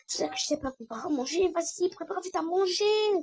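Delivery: high, rushed complaint; play-acted hurried retort; urgent protest. Acted quarrel over making food.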